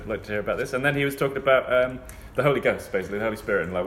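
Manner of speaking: ordinary delivery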